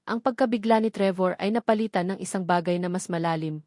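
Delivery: plain reading voice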